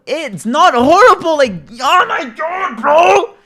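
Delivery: slow, high, loud exasperated shout; outraged shout. Real, not acted. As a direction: shout it down in disbelief. Streamer reacts to comment with outrage.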